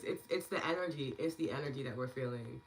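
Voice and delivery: normal talking voice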